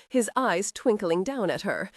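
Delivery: ordinary delivery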